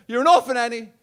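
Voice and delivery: high, loud jeering taunt; mock taunt. Film character taunting Annie, loud high jeer. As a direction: jeer it like a playground bully. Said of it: "someone says".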